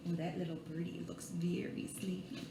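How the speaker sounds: fast, hushed whisper aside. Acted. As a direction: whisper so the bird stays asleep. Aside about the sleepy bird.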